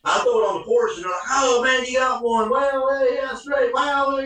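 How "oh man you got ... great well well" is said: excited hosts. Retold or imitated. The family reacting to the turkey.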